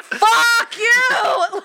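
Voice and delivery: high, loud shouted curse through laughter; hammed-up laughing yell; laughing mock shout. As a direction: yell it at the screen half laughing. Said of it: "He's like".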